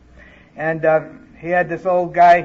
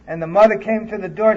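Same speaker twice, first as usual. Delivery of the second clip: usual speaking voice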